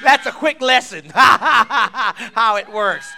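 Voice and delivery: high, loud words broken by laughter; loud laughing speech. Real, not acted. Preacher giving a quick lesson, loud laughing speech. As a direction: belt it out while cracking up.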